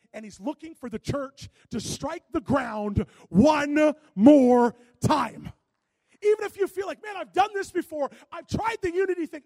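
loud, rising sermon build; preaching at full force. Acted. Pastor preaching to his church, loud and rising. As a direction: drive it home to the congregation.

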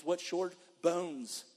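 ordinary delivery

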